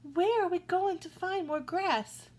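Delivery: high, anxious goat voice; worried middle goat. A narrator's reading. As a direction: ask it high and fretful.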